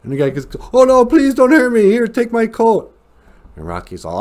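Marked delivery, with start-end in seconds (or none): 0.7-2.9 hammed-up pleading. Debtor begs Rocky for mercy.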